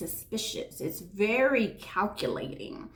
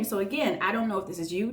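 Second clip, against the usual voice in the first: ordinary talk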